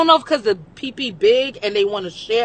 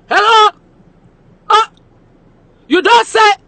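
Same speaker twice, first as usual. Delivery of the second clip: loud, high voice answering the phone; 11 dB louder than usual. Retold or imitated. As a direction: bark into the phone like a hustler. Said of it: "every time the phone ring".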